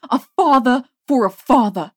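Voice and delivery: loud screamed retort; screaming. A narrator's reading. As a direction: scream it straight back. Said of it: "she screamed back".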